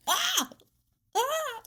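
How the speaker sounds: high, shrill mock scream of shock. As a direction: shriek like a startled victim. Mock scream at stabbing story.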